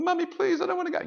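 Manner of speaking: put-on very high, loud little-boy whine; pleading child. Boy begs mother not to send him to school, high pleading whine. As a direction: beg in a squeaky acted kid voice. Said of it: "Pushes him off to school".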